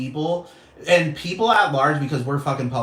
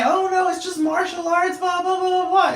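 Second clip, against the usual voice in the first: much higher mock-dismissive voice; mocking quote. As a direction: parody their excuse in a squeaky sneer. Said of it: "they wanna say".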